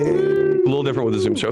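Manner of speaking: high, loud aside; surprised aside. Real, not acted. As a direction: blurt it out in surprise. Aside to Zoom audience.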